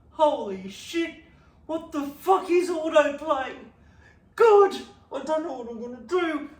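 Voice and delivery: high, loud disbelieving rant; shouting in comic outrage; exasperated panic. Retold or imitated. Panic at autoplay.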